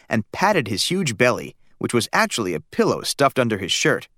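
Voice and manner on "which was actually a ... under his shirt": plain reading voice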